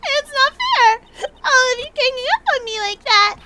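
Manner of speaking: high, whiny fake sobbing protest; mock crying. Witness whining at the lawyers, hammed-up crying. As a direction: wail it like a spoiled child.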